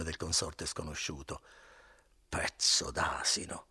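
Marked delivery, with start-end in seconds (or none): 2.3-3.7 slow whispered contempt; muttered thought, whispered; whispered insult. Acted. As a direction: hiss it under your breath. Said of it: "disse nella sua mente".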